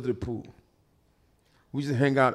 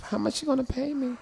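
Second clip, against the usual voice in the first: high put-on girly voice; put-on phone-chat voice; 12 semitones higher than usual. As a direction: stay in the high fake chat-girl voice. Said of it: "some guy that sound like this".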